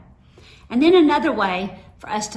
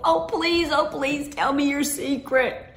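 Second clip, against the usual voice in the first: put-on higher, pleading child voice; begging little sister; 5 semitones higher than usual. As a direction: plead for the secret.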